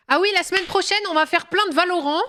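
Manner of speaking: high excited announcement; sudden burst of excitement. Real, not acted.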